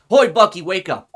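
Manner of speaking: loud wake-up shout at a sleeper; calling out loudly. Acted. Blackwell yells to wake the sleeping client, loud. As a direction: bellow to rouse him.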